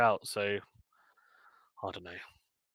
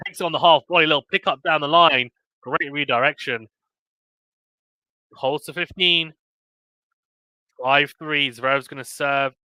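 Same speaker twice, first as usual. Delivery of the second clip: lively, higher tennis commentary, calling each point; excited live sports call; play-by-play; 4 semitones higher, 6 dB louder than usual. Real, not acted. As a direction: call the rally as it happens.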